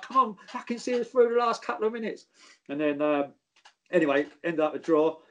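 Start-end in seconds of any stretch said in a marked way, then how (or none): 0.0-2.2 high-pitched teasing urging; winding him up. Retold or imitated. Player at the corner flag urging the injured teammate, high and teasing. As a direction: gee him up, half joking. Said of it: "I'm trying to wind him up".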